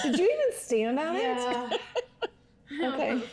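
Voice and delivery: laughing hard while talking; cracking up mid-question. Real, not acted. Laughing at friend's weigh-in.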